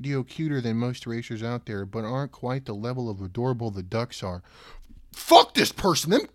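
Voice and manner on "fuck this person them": loud, high, heated outburst; mock rant. Real, not acted. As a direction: tear into the reviewer half for fun. Ranting at a review.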